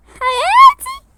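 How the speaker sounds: very high, drawn-out loud squeal; loud, high gushing cry. Acted. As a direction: squeal the endearment, stretching it out. Gushing endearment to friend.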